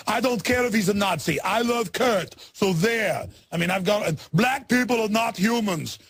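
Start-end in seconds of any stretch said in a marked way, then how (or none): 0.1-3.3 higher-pitched mock Austrian accent; Arnold Schwarzenegger. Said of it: "and said, quote". 4.3-6.0 slow, higher mock Austrian accent; Arnold Schwarzenegger. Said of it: "these are all quotes on record".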